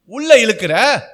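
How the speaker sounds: high, loud mock scold of a sister. Preacher voicing Mary scolding her sister, mock loud scold. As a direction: snap back at the shouting sister, half in jest. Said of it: "மூணாவது மனுஷன்".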